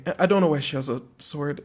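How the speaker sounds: ordinary talk, nothing marked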